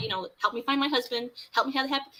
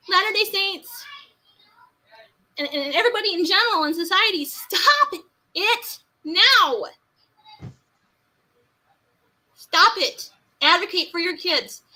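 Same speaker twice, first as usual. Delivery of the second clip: raised high call with a peak on stop; impassioned plea; 7 semitones higher, 5 dB louder than usual. Real, not acted. Addressing Latter-day Saints and society: loud plea. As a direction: demand it loudly of everyone. Calling out to the church.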